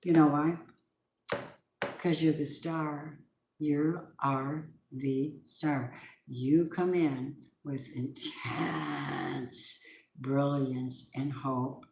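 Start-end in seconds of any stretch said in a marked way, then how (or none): 2.0-11.9 soft, slow, hushed words of hope; slow down and let it glow; tender encouragement. Real, not acted. Reader tenderly telling Aquarius they are the star, soft and slow. Inspirational reading moment.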